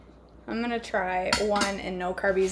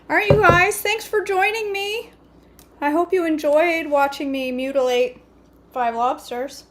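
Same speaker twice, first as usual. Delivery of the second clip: higher, louder bright farewell; cheerful sign-off; 7 semitones higher, 5 dB louder than usual. Real, not acted. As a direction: wave the viewers goodbye.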